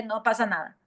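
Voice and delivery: ordinary delivery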